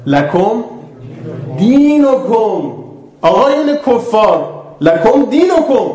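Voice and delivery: high, loud, drawn-out proclamation; declaimed verse. Acted. Preacher addressing the unbelievers with the verse, loud and slow.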